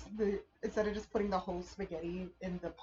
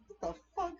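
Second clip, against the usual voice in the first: baffled neighbors; 12 semitones higher than usual. Retold or imitated. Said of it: "and they're like".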